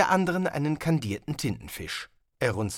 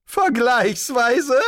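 higher, louder, slow drawn echo; appalled echo; 12 semitones higher, 8 dB louder, much slower than usual. Acted. Wizard appalled at the host's wording, loud and slow. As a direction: repeat it in disbelief.

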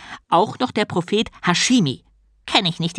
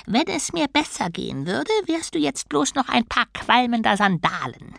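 a slightly higher voice for a sulky turtle god; lightly voiced grumpy turtle; 8 semitones higher than usual. Turtle god threatens doubting novice, lightly grumpy. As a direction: play the put-out turtle, pitched up.

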